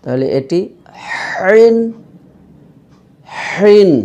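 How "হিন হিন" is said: হিন with h pressed from mid-throat; throat-squeezed H. Shown as a demonstration. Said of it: "কণ্ঠনালীর মাঝখানে চাপ দিয়ে".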